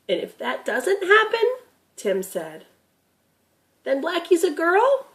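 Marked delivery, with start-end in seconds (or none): 0.1-1.5 put-on lightly raised, curious boy; a young grandson asking, a bit higher; boy Tim's voice. Grandson asking Gramp about the deer, curious boy voice. 3.8-5.1 put-on lightly raised, curious boy; a young grandson asking, a bit higher; boy Tim's voice. Grandson asking Gramp about the deer, curious boy voice.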